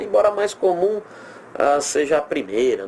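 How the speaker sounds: ordinary delivery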